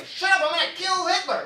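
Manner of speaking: high, loud mock rant; manic meth user. Meth user shouting back at John, high and loud. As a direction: ham up a wild-eyed crusader. Ad dialogue voiced.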